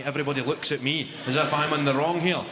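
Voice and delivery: usual speaking voice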